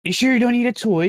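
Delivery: high, eager shopkeeper voice; toymaker voice. Acted. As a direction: pitch it up like a creepy toymaker.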